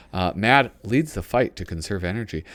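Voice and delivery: ordinary talk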